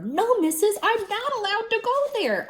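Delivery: high, loud panicked pleading; a girl begging her teacher not to go to the office. A narrator's reading. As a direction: beg not to be taken away.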